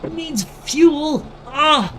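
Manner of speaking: high, slow hammed-up clown voice; silly clown. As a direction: squeal it out like a goofy clown. Hammed-up character voice.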